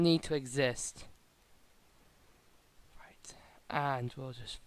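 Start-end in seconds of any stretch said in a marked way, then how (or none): 3.0-4.6 hushed whisper. Real, not acted. Sneaking up in game.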